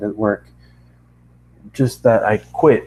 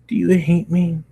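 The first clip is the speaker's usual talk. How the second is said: timid question; 8 semitones higher than usual. Acted. Anxious pony asks.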